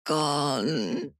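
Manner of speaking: soft, high, drawn-out word; weak moan. A narrator's reading. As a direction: moan it out with little strength. The dying woman answers weakly.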